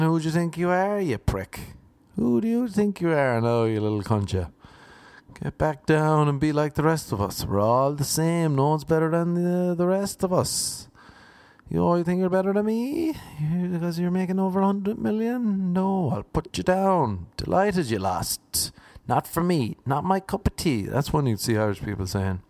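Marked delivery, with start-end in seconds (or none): 0.0-4.5 raised pitch, scornful put-down; mock-indignant scolding; indignant begrudger. Acts out begrudging Irish people. 5.4-20.8 higher, indignant begrudger voice; mock-resentful, raised-pitch griping; begrudging put-down. Acts out begrudging Irish people.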